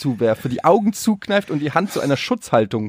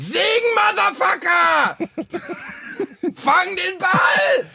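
hammed-up furious yell, much higher; mock brutal shout; much slower than usual. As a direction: scream it like a tyrant father forcing his son. Parodie brutaler Vater, Gürtel. (gespielter wütender Brüllvater)

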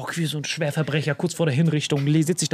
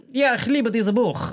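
high, slow put-on Russian accent; 6 semitones higher, slower than usual. Russian friend feigning love for a maths book, Russian accent. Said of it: "der war halt ein Russe".

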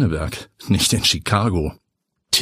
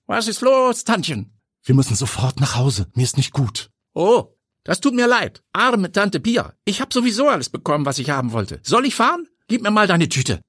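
put-on much higher, boyish voice; young nephew voice; 12 semitones higher than usual. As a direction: play the chirpy young nephew. Junger Neffe spricht. (viel höhere, jungenhafte Stimme)

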